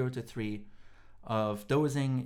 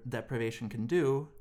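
ordinary talk, nothing marked

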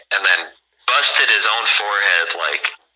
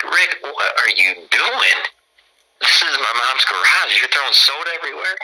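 half-shocked scolding of a friend; disbelief; 6 semitones higher than usual. Retold or imitated. Friend spiking soda in mom's garage, rising incredulous scold. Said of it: "I'm like".

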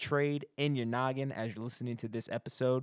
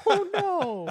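high laughing "oh no"; cracking up mid-words; 7 dB louder than usual. Real, not acted. Laughing at awful live audio.

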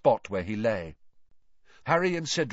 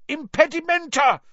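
high, loud yelled jinx; yelled spell; 6 dB louder than usual. Acted. Harry yelling at the charging Scroot, high loud cry. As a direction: scream the jinx in panic. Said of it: "Harry yelled".

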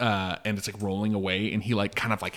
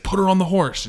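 loud, high, bossy order; aggressive demand; 10 semitones higher, 8 dB louder than usual. Retold or imitated. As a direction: bark it at the wife. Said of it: "He's, like, very aggressive".

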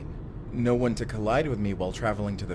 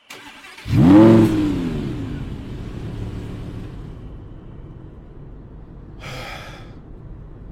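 long loud sigh of relief; heavy sigh; 2 semitones lower, 10 dB louder than usual. Acted. Escaped fugitive sighing loudly after the getaway. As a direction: let out a big exhausted sigh.

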